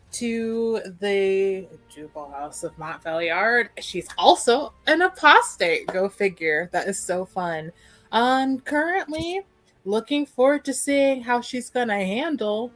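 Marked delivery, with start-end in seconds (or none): none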